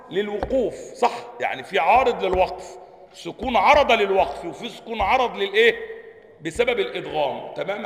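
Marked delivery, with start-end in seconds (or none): none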